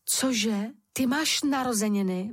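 usual speaking voice

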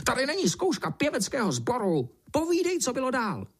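high, screechy command; squawking, exasperated beetle voice; beetle's shrieking. A narrator's reading. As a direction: shriek impatiently at the singer. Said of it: "zaječel černý brouk".

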